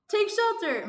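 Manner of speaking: high, loud call to take cover; acted warning cry. A narrator's reading. Team warning of sharks, hammed-up alarm. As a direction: sound the alarm theatrically.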